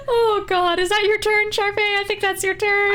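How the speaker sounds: real high-pitched voice after laughing; helpless amusement. Real, not acted. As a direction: squeak it out through leftover laughter. GM recovering from a laughing fit.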